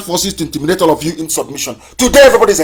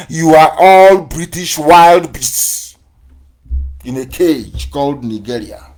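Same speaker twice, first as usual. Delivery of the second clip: 1 slow emphatic; slower than usual. Real, not acted. Insulting declaration.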